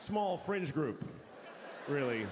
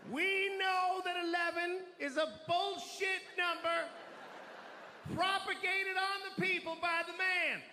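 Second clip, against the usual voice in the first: high-pitched, strained rallying cry; slow, stretched-out numbers in a high protest voice; fervent protester; 13 semitones higher than usual. Acted.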